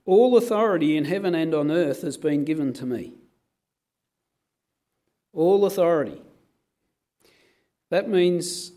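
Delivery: loud, forceful preaching. Acted. Preacher proclaiming Jesus' authority over darkness, full force.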